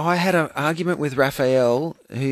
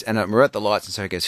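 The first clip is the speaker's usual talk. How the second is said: ordinary talk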